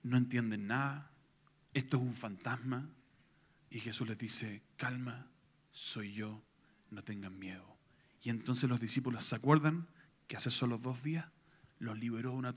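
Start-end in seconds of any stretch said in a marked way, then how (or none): 4.8-7.8 gentle reassurance. Acted. Jesus calms frightened disciples.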